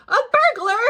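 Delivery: high, loud cry of burglar; sudden high shout; shouted alarm. Acted. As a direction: yell the alarm down the stairs. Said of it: "ran down ... and shouted".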